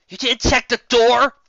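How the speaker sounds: loud, high, slow accusation; incredulous scolding; loud slow incredulous question; disbelief. Acted. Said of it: "eyes slowly narrowing".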